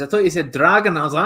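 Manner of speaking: ordinary delivery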